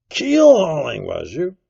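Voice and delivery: "Keel-hauling" cried high, then dropping sharply; shouted, scornful repetition; loud echo. A narrator's reading. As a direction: throw his word back in a shout. Silver echoes Morgan's answer loudly.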